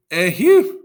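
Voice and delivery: loud, high lamenting cry; wailing shout. A narrator's reading. A Greek soldier lamenting Achilles' death, loud high wail. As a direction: wail out in despair. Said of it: "One of the Greeks shouted".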